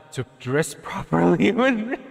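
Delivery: words rising as laughter takes over; real helpless giggles while reading; breaking into laughter. Real, not acted. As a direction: lose it laughing mid-sentence. Cracking up reading a viewer's complaint.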